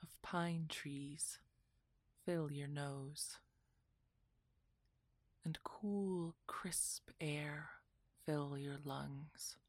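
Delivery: ordinary delivery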